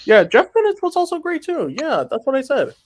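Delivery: high, loud defensive reply; defensive high protest. Real, not acted. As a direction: insist you said it.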